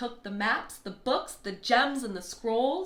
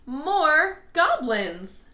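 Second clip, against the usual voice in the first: loud, slow, higher cheer; triumphant cry; 6 semitones higher, 9 dB louder, much slower than usual. Acted. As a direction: proclaim it with glee. Goblins reveal themselves triumphantly.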